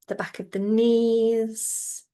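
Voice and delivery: ordinary talk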